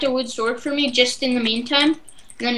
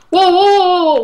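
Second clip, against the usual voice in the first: startled whoa; 7 semitones higher, 8 dB louder than usual. Real, not acted. Sudden attack in game.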